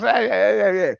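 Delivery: lightly squawked parrot chatter. Acted. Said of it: "the parrot goes".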